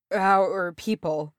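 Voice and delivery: slow, drawn-out, dying wonder; trembling lips. A narrator's reading. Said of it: "his lips trembled".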